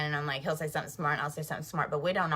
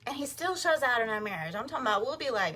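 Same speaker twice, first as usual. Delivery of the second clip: ordinary delivery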